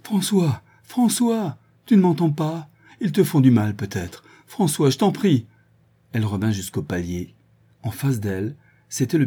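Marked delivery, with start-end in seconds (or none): 0.0-5.5 high, frantic calls and pleas; frantic pleading. A narrator's reading. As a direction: beg him to answer. Desperate search for son.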